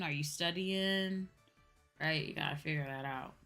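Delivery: normal talking voice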